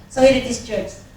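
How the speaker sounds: ordinary talk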